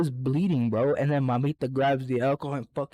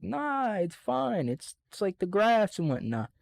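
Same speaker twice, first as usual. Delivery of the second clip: higher, lightly voiced careless kid; kid brushing it off; 7 semitones higher than usual. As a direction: shrug it off like a little kid who knows better. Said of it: "I'm like".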